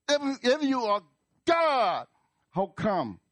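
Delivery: high, loud challenge; angry at God. Acted. Doubter challenging God to explain, angry shout. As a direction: shout it upward as an accusation. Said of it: "you bring out your anger at God".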